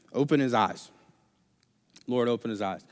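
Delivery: low, soft, steady prayer; quiet prayer. Retold or imitated. Elisha praying for his servant's eyes, quiet prayer. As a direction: pray it calmly, under the breath. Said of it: "Elisha just prays".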